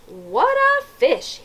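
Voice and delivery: drawn out in wonder; policeman's exclaim. A narrator's reading. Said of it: "he said".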